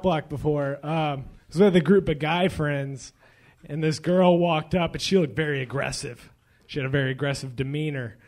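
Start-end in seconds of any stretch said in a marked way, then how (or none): none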